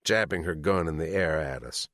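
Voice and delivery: ordinary delivery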